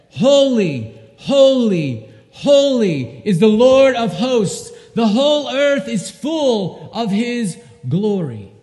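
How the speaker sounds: loud, slow cry of praise; ringing proclamation. A narrator's reading. Preacher reading the seraphim crying to one another, loud and slow. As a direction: call it out in awe across heaven. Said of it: "one called to another and said".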